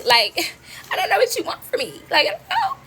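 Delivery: high, broken sobbing voice; crying in despair. Retold or imitated. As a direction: wail it out hopelessly. Overwhelmed crying to mother.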